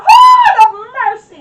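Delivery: loud, slow cry to God; desperate loud plea. Retold or imitated. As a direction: call out for mercy at full voice, dragged out. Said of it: "I cried out".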